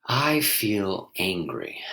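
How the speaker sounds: a slow, bare statement of anger; slow, plain admission. Shown as a demonstration. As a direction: say it very slowly, no explanation. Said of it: "Try on ... these different statements".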